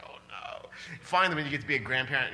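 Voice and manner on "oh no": breathless whispered oh no; whispered dismay. Retold or imitated. Parent biting their tongue, whispering in quiet dismay. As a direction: hold it in, just breathe the words. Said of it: "You're like".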